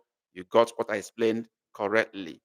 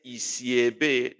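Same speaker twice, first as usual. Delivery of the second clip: "Ị si ebe?" slowly, every syllable clear; slow model question; slower than usual. Shown as a demonstration. Teacher modelling the question slowly for students. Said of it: "But when I say".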